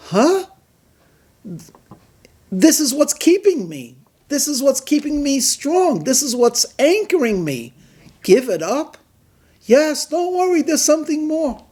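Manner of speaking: high, bewildered self-talk; surprised inner voice. Acted. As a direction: react in disbelief to yourself. Listener's inner voice, questioning.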